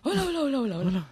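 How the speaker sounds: words spoken on an inhaled breath; talking while inhaling. Shown as a demonstration. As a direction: speak while pulling air in. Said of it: "Metes aire mientras metes aire y hablas".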